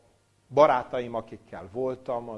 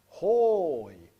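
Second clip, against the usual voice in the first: long drawn-out, high, loud "hoj"; loud stretched cry of woe; imitated chanted lament; 7 semitones higher, 10 dB louder than usual. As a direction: wail it out slowly like praying rabbis. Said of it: "mindig meghallottam".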